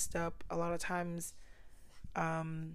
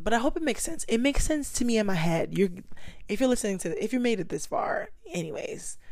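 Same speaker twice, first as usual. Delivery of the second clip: normal talking voice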